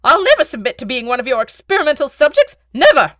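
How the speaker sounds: high, fast, frantic shouting; scream. Acted. Beetle refusing to be the Baron's subject, screaming. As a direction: scream defiance in a panic. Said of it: "changed to a scream".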